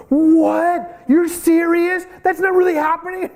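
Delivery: high, incredulous gasping questions; shocked disbelief. Retold or imitated. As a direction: play the stunned newcomer who can't believe it. Shocked doctor voiced in story.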